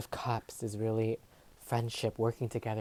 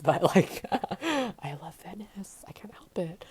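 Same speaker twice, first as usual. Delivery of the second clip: giggly, higher, trailing into a whisper; laughing confession; 7 semitones higher, slower than usual. Real, not acted. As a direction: laugh it off, a little embarrassed. Laughing admission about Venice.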